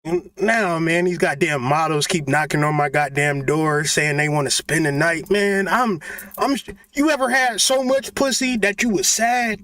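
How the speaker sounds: ordinary talk, nothing marked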